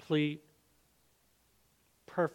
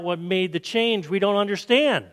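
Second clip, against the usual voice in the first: louder, higher puzzled outcry; crowd crying out; 4 semitones higher, 6 dB louder than usual. A narrator's reading. People at auction cry out, amazed and loud. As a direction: call out in wonder as the crowd. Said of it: "And the people cried out".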